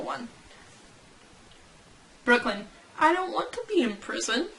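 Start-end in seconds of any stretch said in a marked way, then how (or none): 3.0-4.5 small, higher plaintive voice; high-pitched whine. Acted. Brooklyn complaining about prison, high mock whine.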